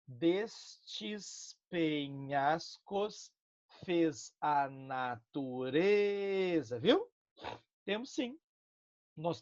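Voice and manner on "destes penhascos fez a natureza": syllable by syllable, very slow, counting the beats; slow scansion. Shown as a demonstration. Teacher counts the metre for students, very slow and deliberate. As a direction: tap out each poetic syllable slowly. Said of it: "Será que tem métrica?".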